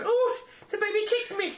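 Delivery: put-on very high, breathy girlish. [name] cooing at the pregnant friend, very high mock. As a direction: coo it like a baby-voiced pop star.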